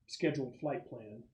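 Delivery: ordinary talk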